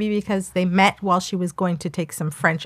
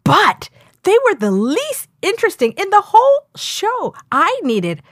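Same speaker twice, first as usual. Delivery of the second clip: raised, animated reading with high jumps; sassy comment reading; 6 semitones higher than usual. Acted. Co-host acting out a viewer's harsh review, sassy. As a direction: perform the review with sass. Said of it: "Then it was just like".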